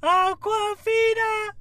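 high hammed-up wailing of the name; mock mournful wailing. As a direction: wail her name like a fake mourner.

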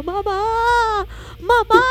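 high, loud wail for mum; mock cry for help. Brother calling mum from the cold shower, mock wail. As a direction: imitate a kid howling for rescue.

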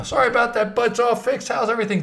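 high, brisk apology in impression; Larry David. Accountant smoothing over tax mistake, high Larry David impression. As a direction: toss off a high-pitched comic apology. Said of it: "just like in Larry David's voice".

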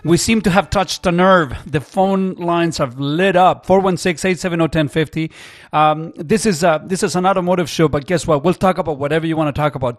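loud, punchy on-air announcer read; radio host voice. Acted. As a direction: sell the show to listeners. Radio host plugging show, back from break.

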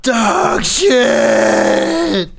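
high, loud drawn-out scream. Acted. Player's character sees a companion down, screaming. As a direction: scream the name at full volume. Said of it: "I scream".

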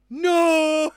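loud high drawn-out cry of No; mummy's scream. Retold or imitated. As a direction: yell it like the defeated mummy. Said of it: "how the mummy comes over and goes".

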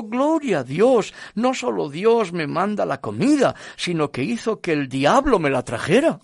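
loud, high, exultant cry; jubilant praise. Acted. Woman jumping and clapping praises God, jubilant. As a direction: rejoice loudly with swooping pitch. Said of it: "empezó a brincar y a aplaudir".

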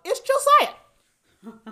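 loud, high, drawn-out mock surprise; dramatic reveal. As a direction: blurt the name out high and stretched. Sudden reveal of who knocked.

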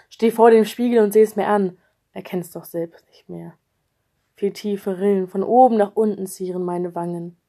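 ordinary delivery, nothing marked

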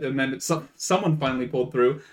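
ordinary talk

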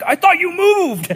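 loud, high, drawn-out outraged cry; losing his mind. Retold or imitated. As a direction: imitate a neighbor flipping out. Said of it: "lost his mind when he saw me".

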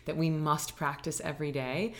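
usual speaking voice

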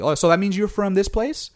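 curious local. Retold or imitated. Locals tracing lineage, quoted.

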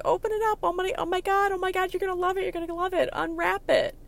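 very high squealed open it up; squealing urge. Retold or imitated. Friend urging her to unwrap the gift, squealing.

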